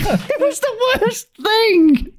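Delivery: high, slow, laughing through the words. Real, not acted. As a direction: crack up at your own story. Laughing at own awkward story.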